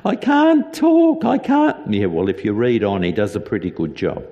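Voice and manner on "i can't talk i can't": high, whiny pleading, louder; a panicky excuse in a pinched high voice; protesting Moses. Retold or imitated. Preacher voicing Moses pleading with God, high and whiny. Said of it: "Here is Moses".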